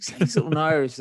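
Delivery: high, loud pleading request; lightly voiced keen tourist; eager backpacker. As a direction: beg them for some Irish. Travellers asking again.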